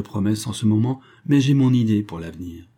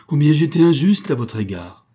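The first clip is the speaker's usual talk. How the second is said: higher and louder outburst of regret; exclaiming; 6 semitones higher, 6 dB louder than usual. A narrator's reading. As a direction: cry out his remorse. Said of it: "s'écria William Bolton". (s'écrier, plus aigu et plus fort)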